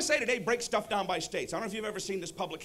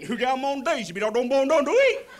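louder and higher gibberish in West Virginia dialect; West Virginia hillbilly; 4 semitones higher, 9 dB louder than usual. Acted. West Virginian answering a baffled traveler, incomprehensible hillbilly accent. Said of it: "was from West Virginia".

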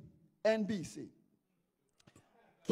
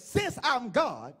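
higher, emphatic oath in God's role; preacher voicing God; 6 semitones higher than usual. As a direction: proclaim it as God, in measured bursts. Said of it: "God says".

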